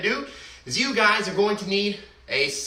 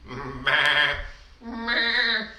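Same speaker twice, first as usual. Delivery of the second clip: sheep bleat; 9 semitones lower than usual. Acted. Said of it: "A sheep".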